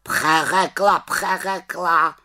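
high bird squawk, echoing in mockery; high-pitched raven voice; raven croak. A narrator's reading. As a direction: croak the repeat like a scornful raven. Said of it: "krákal havran".

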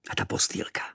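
soft unvoiced marvel; boy marvelling at the little room, whispering; whispered wonder. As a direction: breathe it in awe.

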